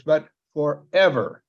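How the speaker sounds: slow, weighty FOREVER with EVER punched; "forever" drawn out, falling at the end; stress on FOREVER. Shown as a demonstration. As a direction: set "forever" apart and land on it. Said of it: "an emphasis on this word forever".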